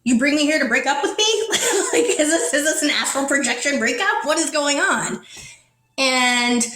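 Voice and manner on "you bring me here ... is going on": high, half-laughing mock disbelief; retold incredulous protest, pitched up. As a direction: act out baffled questioning with a laugh. Acting out her shocked breakup question.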